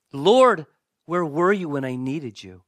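loud cry of "Lord", then easing; mock-prayer outburst of reproach; acted anger at God. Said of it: "ever been mad at God before".

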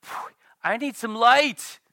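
pastor's mock overwhelmed sigh; exasperated sigh. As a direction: blow out the tension. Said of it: "And it's like".

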